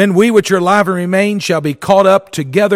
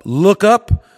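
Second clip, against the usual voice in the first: raised, louder urging with lifted pitch; rousing exhortation; 5 semitones higher, 5 dB louder than usual. Real, not acted. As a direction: call it out to rouse the congregation. Preacher exhorts congregation to look up.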